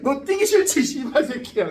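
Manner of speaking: high, loud imitated outburst; mock angry shout. Instructor cursing out a stalling paratrooper, mock angry shout. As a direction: play an exasperated instructor blowing up.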